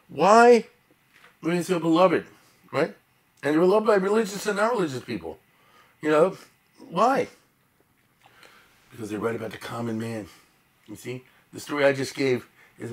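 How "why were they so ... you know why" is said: why, thrown up high and loud; emphatic rhetorical question. Real, not acted. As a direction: demand the answer from the room.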